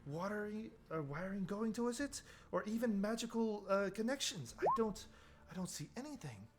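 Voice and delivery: high-pitched, inquisitive character voice; fascinated investigator, high and searching; puzzled tinkerer. Acted. Voices Vaughn inspecting the console.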